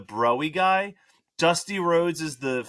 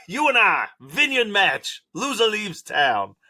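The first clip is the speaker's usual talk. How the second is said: wrestling promo; 6 semitones higher, 4 dB louder, slower than usual. Pretend. Mock wrestling promo challenge.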